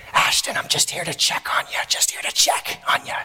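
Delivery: whispered bedside check-in; hushed whisper. Retold or imitated. Nurse to sleeping patient in early morning, whispering. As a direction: whisper it so nobody wakes.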